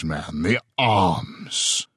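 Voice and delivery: the arms drawn out very slowly and pointedly; man, the arms, dragged out in weary emphasis; slow insistence. A narrator's reading. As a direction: spell it out slowly for a dullard. Stephen presses his point.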